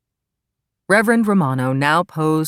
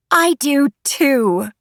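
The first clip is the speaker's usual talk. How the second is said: high, loud, drawn-out delight; eager bride's vow; 10 semitones higher, 5 dB louder, much slower than usual. A narrator's reading. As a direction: belt it out slowly with joy. Bride's eager reply delights audience.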